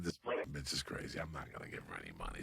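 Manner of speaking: low, soft and fast to himself; low muttered aside. Retold or imitated. Comic telling himself he won't give the woman money, low and muttered. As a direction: grumble it under your breath.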